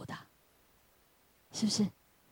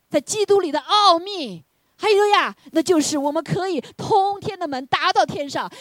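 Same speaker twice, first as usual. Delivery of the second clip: preaching at its climax; fervent preaching; 9 semitones higher, 8 dB louder than usual. Real, not acted. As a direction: proclaim the mystery at full force.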